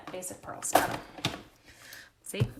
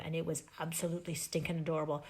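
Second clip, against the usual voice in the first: ordinary talk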